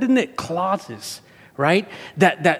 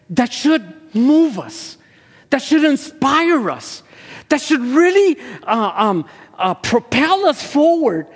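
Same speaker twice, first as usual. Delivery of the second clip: higher and louder, building preacher fervor; fervent preaching; 8 semitones higher, 4 dB louder than usual. Acted. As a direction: preach it out with rising passion.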